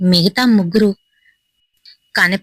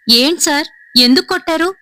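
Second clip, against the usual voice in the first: higher, startled outcry after the slap; shocked question; 7 semitones higher than usual. A narrator's reading. Avinash, slapped by the boss, asks why in shock. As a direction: gasp it out in disbelief. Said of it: "Avinash shock అయిపోయి ఒక్కసారిగా".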